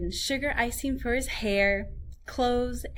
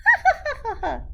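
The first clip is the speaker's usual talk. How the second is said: very high, fast, laughing boast; laughing gingerbread man; 7 dB louder, faster than usual. A narrator's reading. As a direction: giggle and brag at speed. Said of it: "the gingerbread man just laughed".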